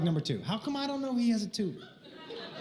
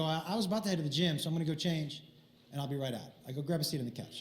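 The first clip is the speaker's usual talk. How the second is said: quiet casual; 6 semitones lower, 8 dB quieter, faster than usual. Retold or imitated. Casual invitation to the tutor.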